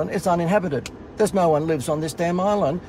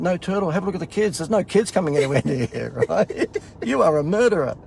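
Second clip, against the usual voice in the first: mock accusation; 4 semitones higher than usual. His retort to the girl.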